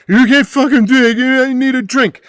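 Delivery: put-on high, loud drunken yell; mock drunk yelling at the bartender. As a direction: shout it like a wasted bar patron. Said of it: "they both start yelling at me".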